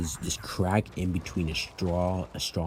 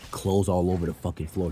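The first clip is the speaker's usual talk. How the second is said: ordinary talk, nothing marked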